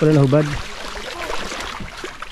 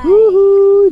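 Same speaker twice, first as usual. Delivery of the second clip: excited whoop; 13 semitones higher, 4 dB louder than usual. Real, not acted.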